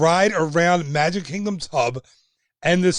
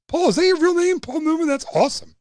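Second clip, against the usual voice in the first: thrilled disbelief at a viewer's name; delighted surprise; 12 semitones higher, faster than usual. Real, not acted. Host amazed a viewer is really Paul Newman, delighted surprise. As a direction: pitch jumps way up, amazed.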